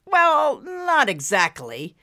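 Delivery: drawn-out reluctant admission; hedging. A narrator's reading. Husband admits to wife about the doors, hedging. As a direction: start high, then slow down and hedge. Husband hedges when challenged.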